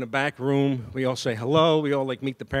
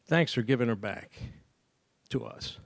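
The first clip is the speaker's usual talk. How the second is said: moved, choked up; 3 semitones lower, 4 dB quieter than usual. Real, not acted. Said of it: "It's still up in there".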